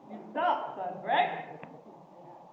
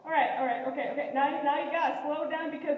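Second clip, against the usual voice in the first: normal talking voice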